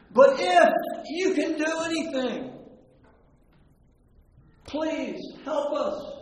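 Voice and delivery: high, loud plea, slowing at the end; pleading. Retold or imitated. Father begging Jesus to help his boy, high and loud. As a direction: beg with everything left.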